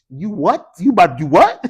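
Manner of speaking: high, loud incredulous challenge; mad wife. Acted. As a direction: play an outraged wife in comic disbelief. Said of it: "make your wife mad".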